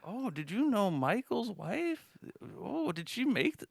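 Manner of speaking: put-on high, slow old lady chatter. Mocking the old ladies in the Ragu ad, high and slow. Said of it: "a bunch of old ladies".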